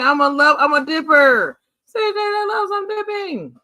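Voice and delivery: high, slow goofy sing-song; drawn out and high, playing it up; silly dipper voice. Teasing Sis Jane back in a high goofy voice.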